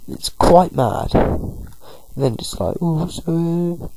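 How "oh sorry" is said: high, slow creature apology. Acted. Snover voiced apologising.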